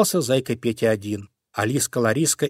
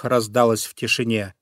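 plain reading voice

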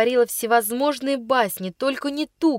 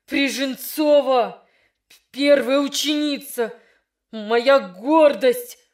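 медленно, с обидой и гневом; голос дрожит от волнения; дрожащий от гнева; 3 semitones higher, slower than usual. Acted. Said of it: "дрожащим от волнения и гнева голосом". (дрожащим от волнения и гнева голосом)

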